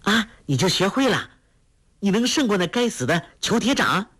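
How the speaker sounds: put-on high, eager girl's voice, rushing in; overjoyed, eager. A narrator's reading. Girl cuts in on her sweetheart, thrilled and high. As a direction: jump in delighted, voice lifted high. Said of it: "黄蓉大喜，抢着说道".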